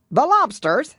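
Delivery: loud, high shout of the Gryphon. A narrator's reading. Gryphon shouting to the Mock Turtle, a loud high shout. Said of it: "shouted the Gryphon".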